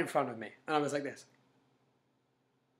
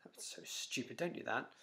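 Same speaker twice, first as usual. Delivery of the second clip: whispered doubting voice; the doubting voice in your head, whispered; inner voice; 3 semitones lower, 12 dB quieter than usual. Retold or imitated. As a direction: whisper the put-down like a nagging thought. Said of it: "you hear a voice saying".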